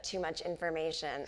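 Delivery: ordinary talk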